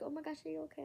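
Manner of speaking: mildly alarmed, imitating a friend; concerned friend. Friend checking on a crying classmate, concerned.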